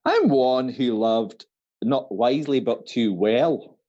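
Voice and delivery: slow put-on Scottish accent; Nicola Sturgeon. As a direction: recite Shakespeare with a Scottish accent. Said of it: "what if he was from Scotland".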